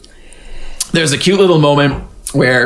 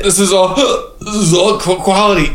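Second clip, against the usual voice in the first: hiccups; 7 semitones higher than usual. Real, not acted. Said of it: "I have the hiccups".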